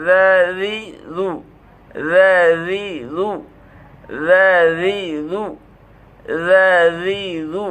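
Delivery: যাজিজু stretched very slowly; very slow, deliberate চ্ছন্দ drill; slow syllable drill. Shown as a demonstration. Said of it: "জিহ্বাটা যাবে".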